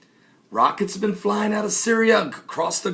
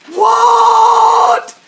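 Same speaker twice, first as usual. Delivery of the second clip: very high loud stretched shriek; screeching what; 13 semitones higher, 9 dB louder than usual. Acted. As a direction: shriek in outraged shock. Shocked reaction to termites.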